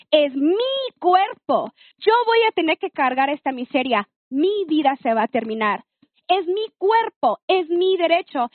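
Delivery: emphatic, defiant retelling of an angry confrontation; retold fury. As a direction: replay a lioness defending her rights, without shouting. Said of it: "salió la leona y me dio un coraje".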